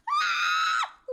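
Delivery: sudden high, loud shriek; startled scream. Real, not acted. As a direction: shriek in real fright. Cannon scares her again.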